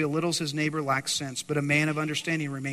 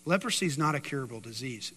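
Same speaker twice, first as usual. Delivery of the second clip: ordinary delivery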